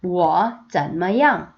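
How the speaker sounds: very slow, each syllable drawn out; slow choral reading; slow read-along. Shown as a demonstration. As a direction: read slowly with the class. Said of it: "한 번 더".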